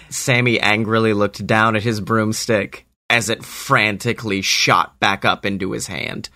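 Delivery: ordinary delivery, nothing marked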